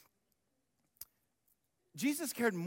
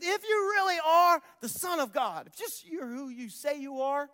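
taunting jeer; 7 semitones higher, 4 dB louder than usual. Retold or imitated. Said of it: "They said".

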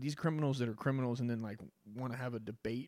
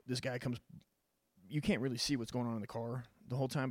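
normal talking voice

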